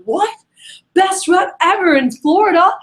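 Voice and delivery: high, outraged disbelief; incredulous exclamation. Retold or imitated. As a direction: imitate a rep loudly boasting in mock disbelief. Retold incredulous caller exclaiming.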